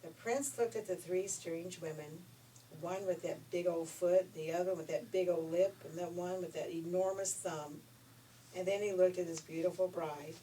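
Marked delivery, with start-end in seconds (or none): none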